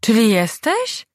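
high, slow prying question; hopeful probing mother. A narrator's reading. Matka dopytuje syna. (wysokie, powolne dopytywanie matki)

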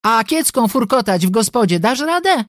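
high-pitched sisterly taunt; cutting jibe. A narrator's reading. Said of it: "docięła jej Krystyna". (uszczypliwy, podniesiony głos)

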